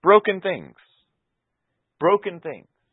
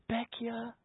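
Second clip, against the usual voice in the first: soft call; 9 semitones higher, 15 dB quieter than usual. Retold or imitated. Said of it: "He's saying".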